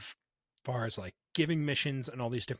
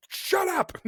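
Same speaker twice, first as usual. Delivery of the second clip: much higher, sharp put-on voice; mock snapping shut up; 4 dB louder than usual. Retelling Clea silencing Luthen, sharp mock snap. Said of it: "She's like".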